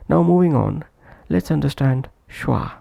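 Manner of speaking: ordinary talk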